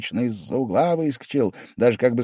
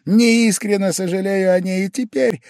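high, loud sudden retort; sprung retort; 12 semitones higher, 8 dB louder than usual. A narrator's reading. Pavel Pavlovich springing back at Velchaninov, high and loud. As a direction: jump out like a spring. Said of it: "выскочил опять".

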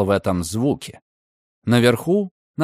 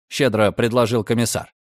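plain reading voice

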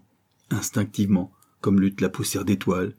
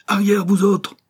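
much higher, loud roar of rage; 12 semitones higher, 6 dB louder than usual. A narrator's reading. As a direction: roar them back, hoarse with fury. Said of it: "rugit-il, la voix rauque". (rugissement rauque et furieux)

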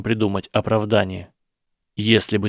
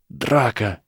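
usual speaking voice

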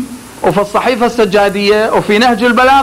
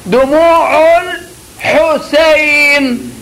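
"دموع" higher, "الحسين" drawn very slowly; higher slow demo; 5 semitones higher, much slower than usual. Shown as a demonstration. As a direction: model "دموع الحسين" high and stretched. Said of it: "هنا لاحظ".

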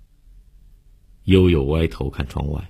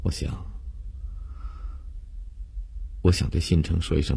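lightly murmured, musing regret; murmuring; 5 dB quieter than usual. A narrator's reading. Condemned girl regretting how she treated Xincheng, murmuring. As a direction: think aloud softly, with a touch of remorse. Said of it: "悠悠突然喃喃地说道".

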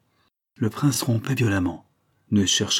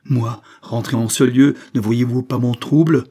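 louder, agitated refusal; distraught protest; 3 semitones higher, 7 dB louder than usual. A narrator's reading. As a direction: protest with a raised, troubled voice. Distraught prince refuses to return.

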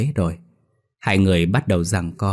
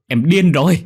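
higher and louder outburst; husband yelling at wife she is mad, higher and louder; furious shout; 8 semitones higher, 6 dB louder than usual. A narrator's reading. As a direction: explode on the repeat of điên.

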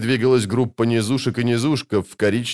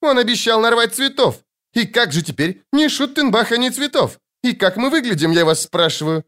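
high-pitched indignant complaint; high, fussy exasperation; agitated crying out; 12 semitones higher than usual. A narrator's reading. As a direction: complain at the top of your range. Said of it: "крикнул Лютик".

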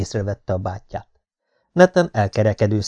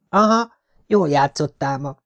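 put-on shy boy; 5 semitones higher than usual. A narrator's reading. Nathan's boy voice.

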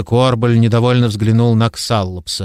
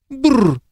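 higher, sharper shivering brrr; high disgusted shudder; 13 semitones higher than usual. A narrator's reading. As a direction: shudder again, pitched high. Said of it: "повторно содрогнулся герцог".

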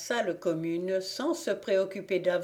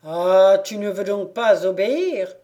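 loud, menacing blacksmith; raised-voice warning before a blow; angry threat; 8 dB louder than usual. A narrator's reading. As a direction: burst out loudly at his disobedience.

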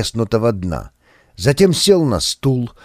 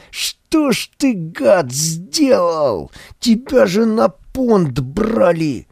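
high, slow despairing reproach; high anguished voice; 7 semitones higher, slower than usual. A narrator's reading. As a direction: wail the blame at him. Мыкин horrified at friend. (высокий отчаянный упрёк)

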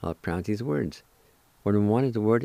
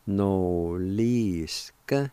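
noliska very drawn out; slow pronunciation; much slower than usual. Shown as a demonstration. Teacher showing how noliska goes, slowly and drawn out. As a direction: stretch every vowel of noliska. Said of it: "it's pronounced like this".

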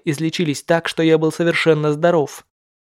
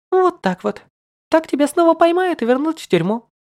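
tie him up and gloat in high voice; teasing girl voice; 12 semitones higher than usual. Acted. (высокий самодовольный девичий голос)

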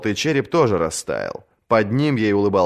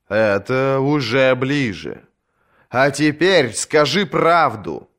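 slow patient priest; 5 semitones higher, slower than usual. A narrator's reading. Priest presses gently.

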